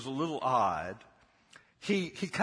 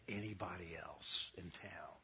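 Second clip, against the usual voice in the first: whispered; 10 semitones lower, 14 dB quieter than usual. Real, not acted. Trails into whisper.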